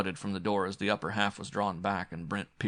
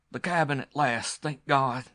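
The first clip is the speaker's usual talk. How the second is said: gasped; 6 semitones higher, 5 dB louder than usual. A narrator's reading. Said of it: "he gasped".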